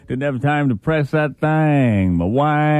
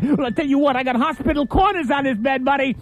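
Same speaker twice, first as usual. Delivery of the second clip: shrill panicky whine, much higher; losing it, higher and higher; frantic high voice; 12 semitones higher, 3 dB quieter than usual. Acted. Frantic man under covers.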